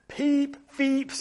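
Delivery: high piping peeps like a referee's whistle; whistle imitation. Storyteller to children, mimicking the whistle with high peeps. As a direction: squeak out the whistle blasts, high. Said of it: "went the whistle".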